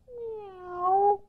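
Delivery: high, soft mew; a little mew looking up; kitty meow. Acted. A kitten mewing up at the lady.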